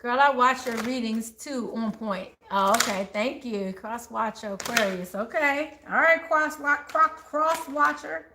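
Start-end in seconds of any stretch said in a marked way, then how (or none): none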